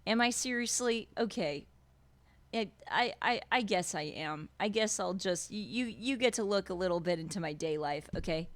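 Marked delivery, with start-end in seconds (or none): none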